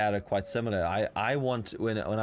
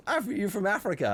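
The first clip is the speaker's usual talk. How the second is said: relative's voice; 10 semitones higher than usual. Retold or imitated. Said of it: "And she's like".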